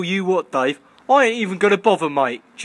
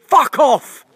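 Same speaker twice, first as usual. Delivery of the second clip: loud, higher shout of fuck off; angry yell; 7 semitones higher, 6 dB louder than usual. Real, not acted. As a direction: yell it at the bird. Yelling at the pigeon.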